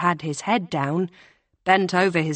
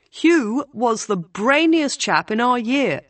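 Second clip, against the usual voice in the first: higher, louder posh voice on "Hugh was the brainiest chap in our year"; light posh public-school praise, pitched up; posh schoolboy Will; 7 semitones higher, 4 dB louder than usual. A narrator's reading. Will boasts about his friend Hugh, in a higher, louder posh voice.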